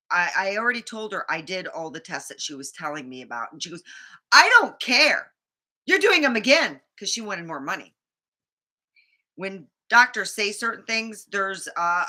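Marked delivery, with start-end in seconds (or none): 4.3-6.8 curt assistant. Retold or imitated. Quoting dismissive assistant.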